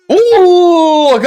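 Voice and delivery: long, loud, high oooh; a sudden drawn-out oooh at a near miss; a fan's stretched-out gasp of surprise; surprised gasp. Real, not acted. Fan reacting to a near chance.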